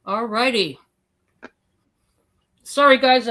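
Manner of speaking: talking through a mouthful of pretzel; words around a bite of pretzel; chewing while talking. Real, not acted. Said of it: "I'm chewing on a pretzel".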